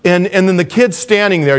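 ordinary talk

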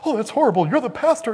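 high, loud mock outrage; exaggerated horrified squeal of disapproval; shocked congregant. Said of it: "Some of you are going".

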